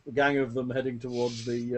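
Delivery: usual speaking voice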